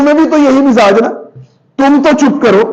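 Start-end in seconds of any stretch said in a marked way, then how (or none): 1.8-2.6 scolding order. Shown as a demonstration. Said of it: "जरा डांट के कहना हो कुछ".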